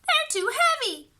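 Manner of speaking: high whiny wail; wailing little girl. A narrator's reading. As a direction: whine like a child under a heavy load. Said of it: "Beatrice wailed".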